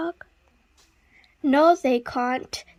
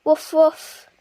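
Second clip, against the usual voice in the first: happy bark; 4 semitones higher, 7 dB louder than usual. Acted. Dog barks at story end.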